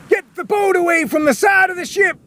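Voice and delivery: very high shouted order; captain orders the rowboat away, shouting. Acted. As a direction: yell at the rowers to pull away. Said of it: "shouted the captain".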